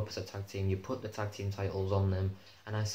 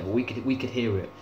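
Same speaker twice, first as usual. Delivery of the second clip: ordinary delivery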